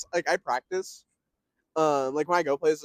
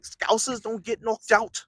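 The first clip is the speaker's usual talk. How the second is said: thick, nasal Scouse, higher and slow; Paddy the Baddy; 6 semitones higher, 3 dB louder, slower than usual. Showing friend the thick nasal Scouse accent. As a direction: lay the nasal Scouse on heavy. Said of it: "it's hella nasally".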